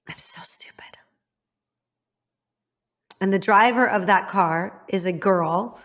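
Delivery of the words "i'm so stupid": real barely audible whisper; voiceless, self-blaming aside; whispered self-reproach. Real, not acted. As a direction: whisper it to yourself in regret. Self-reproach, aside in painful story.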